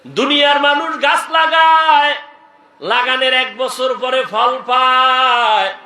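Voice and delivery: high, loud declamation with stretched endings; old man's ringing proclamation; calling out. Acted. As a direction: cry it out to everyone, holding the last word. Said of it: "বুড়ায় ডাক দিয়া কয়".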